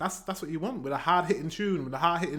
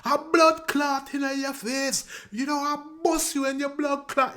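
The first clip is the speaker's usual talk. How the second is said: Jamaican patois; 11 semitones higher than usual. Retold or imitated. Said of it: "almost like he could be speaking patois".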